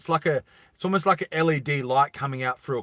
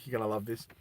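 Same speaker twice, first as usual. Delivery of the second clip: ordinary delivery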